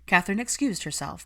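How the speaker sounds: ordinary delivery, nothing marked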